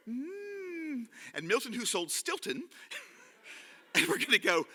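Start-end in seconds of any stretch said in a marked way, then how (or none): 0.1-1.1 high, soft "mmm" of relish; drawn-out mock delight; yummy hum. Shown as a demonstration. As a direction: hum as if tasting cake.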